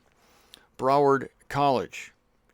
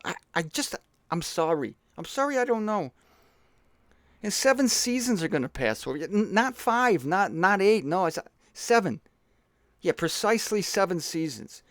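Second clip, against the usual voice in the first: higher-pitched, hesitant, apologetic messenger; Woody Allen; 3 semitones higher than usual. Acted. Messenger unsure of his own decree, hesitant Woody Allen impression. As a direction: fumble the prophecy, stammering and correcting yourself. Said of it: "I was doing Woody Allen".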